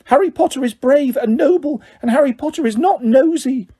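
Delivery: high, eager house-elf squeak; high earnest Dobby voice. A narrator's reading. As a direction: stand up for your hero. Dobby defends Harry.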